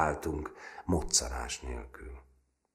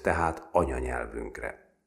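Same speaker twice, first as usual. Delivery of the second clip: ordinary delivery, nothing marked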